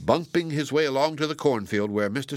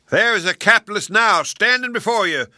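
much higher, louder denouncing shout; shouted accusation; 10 semitones higher, 7 dB louder than usual. A narrator's reading. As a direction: point him out to the mob. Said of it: "shouted Mister Scarecrow".

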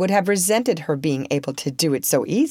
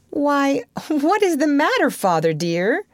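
put-on high-pitched child's question; concerned child; 10 semitones higher than usual. A narrator's reading. Child asks father what is wrong, high concerned voice. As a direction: pipe up in a high, puzzled voice.

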